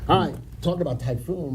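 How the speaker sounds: usual speaking voice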